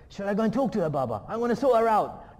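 loud, high, feisty girl imitation; eager daughter. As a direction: offer to confront her, bursting with zeal. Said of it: "my daughter goes".

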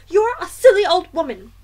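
loud, high, cross wife; irritated dismissal. A narrator's reading. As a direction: shoo her off sharply. Wife insults the grandmother.

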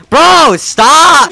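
high, loud yell; protesting shout. Real, not acted. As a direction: shout at a friend to stop. Protest at friend in game.

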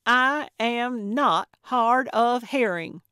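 loud, high, slow, over-enunciated retort; enunciating every word. A narrator's reading. Gertrude argues with Calvin, enunciating every word. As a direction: indignantly pronounce each word separately. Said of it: "taking care to enunciate every word".

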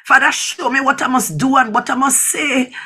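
ordinary talk

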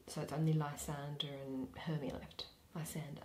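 soft unvoiced aside; whisper. Real, not acted. Reader quietly noting who remains onstage, whispering. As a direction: whisper it like a stage note.

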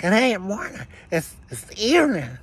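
put-on high piped child's correction; mock little kid voice. Little kid correcting the big neighbor, high and piping. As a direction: squeak it up like a small kid setting him straight. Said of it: "the little kid's like".

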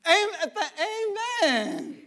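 laughing amen. Real, not acted.

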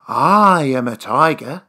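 usual speaking voice

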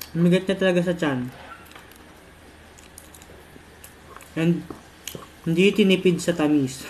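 eating between words; talking while chewing. Real, not acted. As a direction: mouth partly full of food. Seen 2: fork to mouth at 1.0 and 6.1, chewing between.

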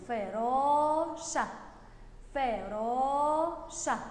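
"فراشة" very slow, long "aa" held; drawn-out word. Shown as a demonstration. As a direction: sound out "فراشة" syllable by syllable. Teacher sounds out the long vowel. (مدّ الألف ببطء)

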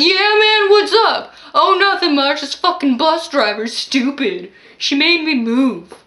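put-on high, loud drunk phone rant; drunk man on the phone.

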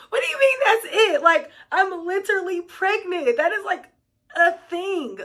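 high-pitched mock indignation; hammed-up, high retold disbelief; incredulous protest. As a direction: protest it, amazed at the underreaction. Retold protest at mom's reaction.

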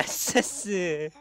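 slow, soft, tense mumbled reply; nervous mumble. Retold or imitated. Nervous young guy answering a compliment, mumbling softly. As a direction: shrink back and mumble nervously. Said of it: "もう緊張で".

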